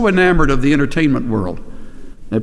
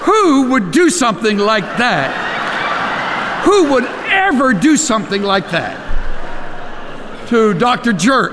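high, hammed-up disbelief; mock outrage; 10 semitones higher than usual. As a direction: play innocent and appalled at the prank. Mock outrage at own group's prank.

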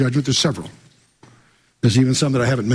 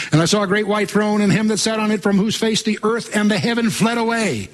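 high, sustained Bible-reading tone; proclaimed scripture reading; 6 semitones higher than usual. Acted. Preacher reads Revelation to congregation, proclaimed scripture reading. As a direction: proclaim the verse on a raised pitch.

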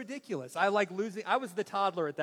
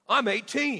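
put-on higher, indignant teen declaration; defiant teenager; 4 semitones higher, 4 dB louder, slower than usual. Son talking back to dad about clothes, defiant. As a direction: mimic a son asserting his adulthood. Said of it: "he goes".

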